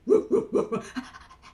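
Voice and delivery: rapid breathy dog woofs; dog panting and barking. Acted. Storyteller plays the dog arriving, panting woofs. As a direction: pant and yap like a dog.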